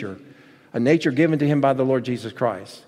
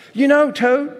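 high, loud storybook voice with a hint of full mouth; 12 semitones higher, 5 dB louder than usual. A narrator's reading. Frog to Toad while eating cookies, mouth full. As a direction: lightly suggest talking through food. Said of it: "said Frog with his mouth full".

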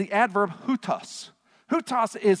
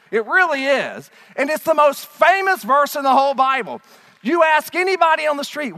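ordinary delivery

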